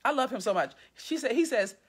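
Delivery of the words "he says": plain reading voice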